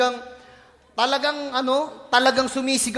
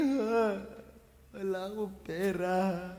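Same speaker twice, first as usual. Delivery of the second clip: mock gloomy sigh; 3 semitones lower, 9 dB quieter, slower than usual. Mock defeated believer.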